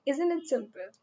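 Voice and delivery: normal talking voice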